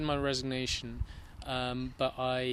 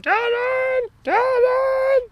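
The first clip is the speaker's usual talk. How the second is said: very high shrill-free yelling of a name, loud; imitating manager Claire; 11 dB louder, much slower than usual. Darren mimics his manager yelling after him as he ran. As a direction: call after him like the odd boss. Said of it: "hear her behind me going".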